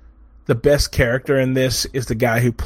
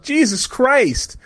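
high, slow, drawn-out disbelief; mock horrified gasp of a name; own shocked reaction; 11 semitones higher, much slower than usual. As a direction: stretch both words out, appalled. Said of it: "I was like".

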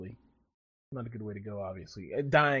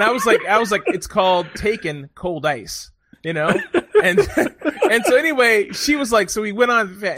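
excited retelling; 7 semitones higher, 9 dB louder than usual. Real, not acted. Excitedly retelling a funny conversation.

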